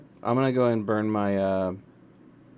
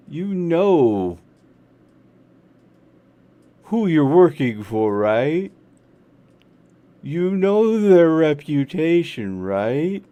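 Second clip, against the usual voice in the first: knowing pressure; 7 semitones higher, 8 dB louder, slower than usual. Acted. Character pressing a hired assassin.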